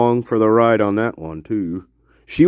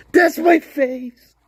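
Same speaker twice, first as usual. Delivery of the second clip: pitched way up and slowed, like a warped audio file; squeaky slowed-down audio effect; pitch-shifted voice; much slower than usual. Shown as a demonstration. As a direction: play it like a voice run through pitch software. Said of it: "after playing with audio files".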